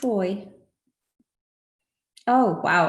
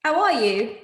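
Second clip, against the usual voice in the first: bright mock British greeting, louder; hammed-up British accent; 4 semitones higher, 6 dB louder than usual. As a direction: ask in a playful put-on British accent. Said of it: "I could speak Brit-, with a British accent".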